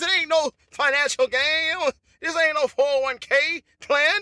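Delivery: ordinary talk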